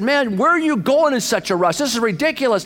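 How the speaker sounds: very high-pitched mock scolding; angry yelling. Highway patrolman scolds a tailgating driver, angry mock yell. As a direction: imitate an exasperated cop yelling. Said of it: "he was ticked".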